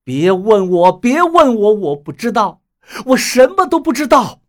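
crouched on the ground, shouting back in anguish; desperate shouting. Acted. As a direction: shout to shut the questions out. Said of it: "赵建国蹲在地上喊道".

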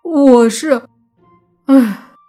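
high, loud, despairing start breaking into a sigh; sighing dejection. A narrator's reading. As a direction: give up explaining with a heavy sigh. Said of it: "泥巴唉声叹气说道". (唉声叹气，无奈作罢)

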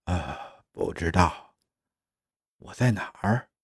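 soft, groggy uncertainty; lost, dazed. Acted. Disoriented clone answering the machine's question, softly bewildered. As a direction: answer quietly, unsure of everything.

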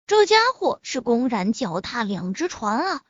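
ordinary delivery

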